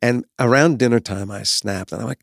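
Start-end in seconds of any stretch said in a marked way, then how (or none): none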